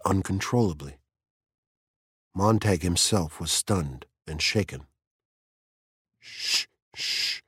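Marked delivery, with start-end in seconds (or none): 6.2-7.4 soft unvoiced shushing; hushing. A narrator's reading. Mildred hushes her crying friend, softly. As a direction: hush someone softly. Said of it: "said Mildred".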